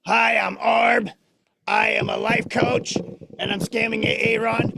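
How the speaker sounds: slow, loud opening, easing slightly; loudly announced puppet parody; mocking Orb impersonation. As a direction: mimic the puppet slowly and emphatically. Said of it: "that was my impersonation of you".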